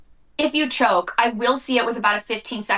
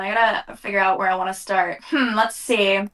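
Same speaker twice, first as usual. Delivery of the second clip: ordinary talk, nothing marked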